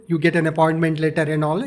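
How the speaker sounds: ordinary talk, nothing marked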